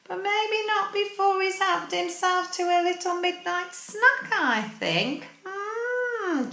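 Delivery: high playful tease; teasing. Real, not acted. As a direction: tease him gently. Teasing aside.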